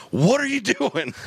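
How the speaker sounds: very high, slow mock disbelief; dad can't believe what his son is doing; incredulous dad. Dad stopping his son's shooting, high slow disbelief. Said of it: "and he's like".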